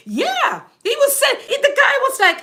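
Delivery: rising in disbelief at the story; excited. Real, not acted. Outraged retelling of racist patient.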